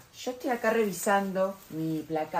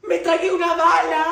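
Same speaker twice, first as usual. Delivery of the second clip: laughing; 8 semitones higher, 9 dB louder than usual. Acted. Kid swallowed a bullet, laughing.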